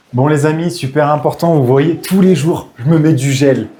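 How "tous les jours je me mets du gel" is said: ordinary talk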